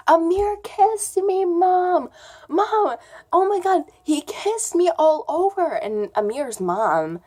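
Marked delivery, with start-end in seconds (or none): 0.1-5.7 high, loud re-enacted tattling; retold shout. Girl tells mom downstairs about the kiss, retold shout. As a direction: call out to mom in a raised voice. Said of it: "I shouted out".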